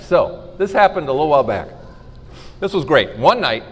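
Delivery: ordinary talk, nothing marked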